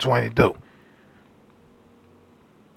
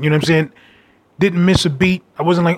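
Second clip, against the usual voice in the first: normal talking voice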